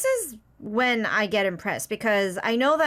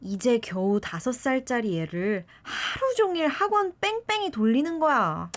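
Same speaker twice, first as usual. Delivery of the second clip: natural Korean way; 3 semitones higher, much faster than usual. Shown as a demonstration. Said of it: "complete hundred percent natural Korean way".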